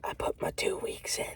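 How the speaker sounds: hushed whisper of the news. Retold or imitated. Telling coworker Vic the news in a whisper. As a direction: whisper it like a secret.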